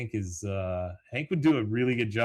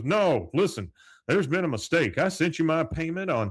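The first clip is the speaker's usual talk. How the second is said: raised, higher voice, mildly heated; mock indignant protest; 7 semitones higher, 4 dB louder than usual. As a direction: act out a customer insisting there's an error. Hank protests a billing mistake.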